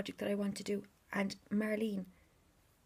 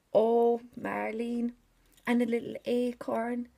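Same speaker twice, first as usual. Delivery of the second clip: delighted gasp; 2 semitones higher, 8 dB louder, slower than usual. Real, not acted. Finding charms, delighted.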